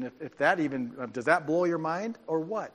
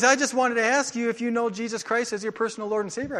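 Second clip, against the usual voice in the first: eager evangelist; 6 semitones higher, 5 dB louder than usual. Retold or imitated. Retelling mall evangelist.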